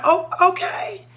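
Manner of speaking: high, drawn-out surprised okay; slow, high-pitched reaction of mild amazement; taken aback. Retold or imitated. Teacher reacting to a child's dancing, taken aback.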